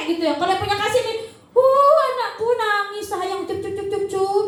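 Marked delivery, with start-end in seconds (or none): 1.5-4.4 soothing parent. Retold or imitated. Parent soothing crying baby.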